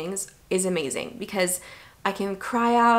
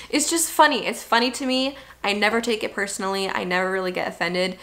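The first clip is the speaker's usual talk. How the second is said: ordinary delivery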